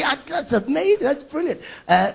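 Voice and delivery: ordinary delivery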